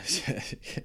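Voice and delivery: a quiet amused chuckle; soft laugh. Real, not acted. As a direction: laugh it off softly under the breath. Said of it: "just cracks me up".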